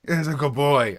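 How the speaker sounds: put-on high, loud good-boy praise; a rookie praising his partner like a dog. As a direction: praise him like a pet.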